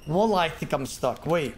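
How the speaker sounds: ordinary delivery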